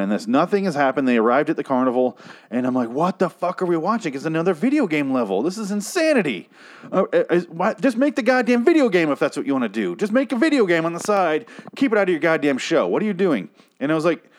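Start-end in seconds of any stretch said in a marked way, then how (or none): 2.9-13.5 high-pitched, incredulous outburst; exasperated rant. Retold or imitated. Viewer rants at a show turned video game, exasperated. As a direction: rant at the showrunners in raised-pitch disbelief.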